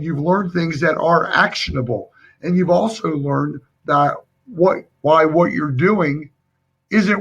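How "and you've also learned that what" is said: normal talking voice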